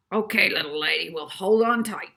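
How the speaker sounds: a hint of the gruff driver recognising it; driver's voice. A narrator's reading. Driver guesses the hotel, lightly voiced.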